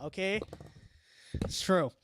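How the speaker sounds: ordinary talk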